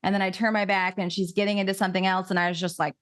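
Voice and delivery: ordinary talk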